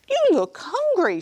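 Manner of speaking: high, kind wife voice; sweet high voice; high giant's wife. Acted. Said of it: "she said".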